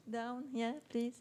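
ordinary talk

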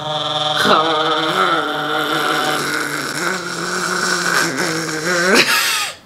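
gargling rattle in the throat; while gargling. Real, not acted. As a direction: rattle the uvula like gargling mouthwash. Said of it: "I sound like I'm gargling mouthwash".

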